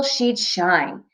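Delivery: plain reading voice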